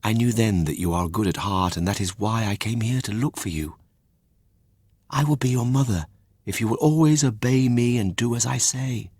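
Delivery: quiet, kind fairy voice; softly spoken fairy. Acted. Fairy speaks in the story.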